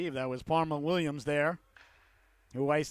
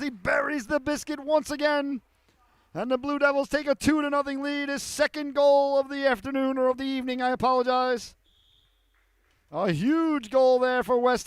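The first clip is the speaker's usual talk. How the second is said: loud, much higher, slowed roaring goal call; 7 semitones higher, 6 dB louder than usual. Real, not acted. Play-by-play announcer calling the Blue Devils goal to the crowd, roaring. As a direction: ride the goal like it shook the arena.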